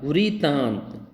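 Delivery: "वृतांत" slowly as vreee-taant; drawn-out correct pronunciation. Shown as a demonstration. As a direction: stretch the ri in "वृतांत". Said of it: "ये री बोलिए". ("वृतांत" धीरे, 'री' खींचकर)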